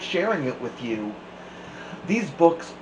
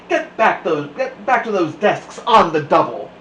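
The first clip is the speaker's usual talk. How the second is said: louder barked command; stern order; 4 semitones higher, 6 dB louder than usual. A narrator's reading. Strict substitute ordering the class to sit. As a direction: snap them back to their desks.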